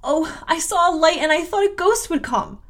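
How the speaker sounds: put-on high-pitched, scared little girl; acted childish fright; frightened child. Frightened child explains, in story.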